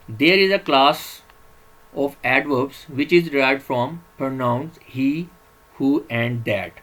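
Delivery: drawn-out teacher voice reading a slide; slow slide reading. Acted. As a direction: read the English heading out word by word, very slowly. Reads slide rule slowly.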